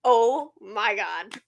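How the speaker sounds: very slow, drawn-out amazement; dumbstruck, stretched-out exclamation; stunned disbelief. Retold or imitated. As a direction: let each word land in retold awe. Retold stunned reaction after waking.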